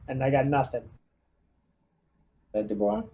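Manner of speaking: ordinary talk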